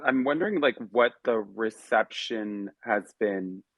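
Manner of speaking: normal talking voice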